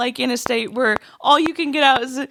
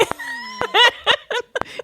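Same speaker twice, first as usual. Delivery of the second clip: high laugh. Real, not acted. Friend cracks up.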